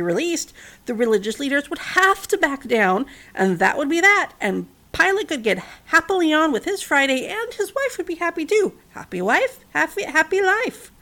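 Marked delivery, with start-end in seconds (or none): none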